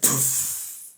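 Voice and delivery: a voiceless puff of air for poof; breathy poof. Pretend. Describing snakes vanishing in dreams, a voiceless poof. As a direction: blow out a little vanishing sound.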